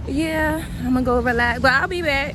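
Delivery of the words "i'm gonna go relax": normal talking voice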